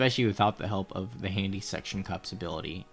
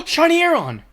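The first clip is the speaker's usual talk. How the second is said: excited shout; 13 dB louder than usual. Real, not acted. Shiny found, live reaction.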